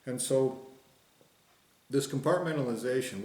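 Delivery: ordinary talk, nothing marked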